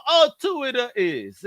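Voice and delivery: high, drawn-out mock Italian reply; pizza-shop Italian accent. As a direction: stretch it like an imitated Italian pizza man. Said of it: "you sound like homie from the pizza shop".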